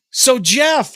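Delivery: high, loud eager interviewer; Eddie Trunk voice. Retold or imitated. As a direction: play the keen radio host asking Jeff. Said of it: "I'm Eddie right now".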